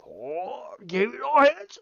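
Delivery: strained, high exaggerated Japanese accent; mock Japanese, like forced constipation. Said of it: "say it in a Japanese accent".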